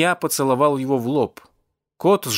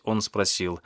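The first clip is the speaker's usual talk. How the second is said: ordinary delivery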